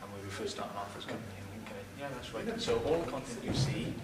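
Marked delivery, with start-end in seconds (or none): none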